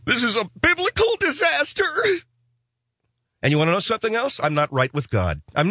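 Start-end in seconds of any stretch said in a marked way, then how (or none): 0.0-1.8 high hammed-up panic; mock panic. Comic declares biblical disaster in high mock panic. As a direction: squeak it out in mock dread.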